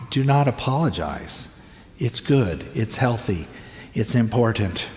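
soft, low pastoral comfort; gentle reassurance. Retold or imitated. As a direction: quietly reassure someone who is crying. Pastor comforting people who cry.

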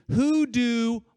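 WHO DO loud and high; emphatic reading. Shown as a demonstration. Preacher reading out Jesus' question as a text, emphatic. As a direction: punch out "Who do" as a big question. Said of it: "there's emphasis on two words".

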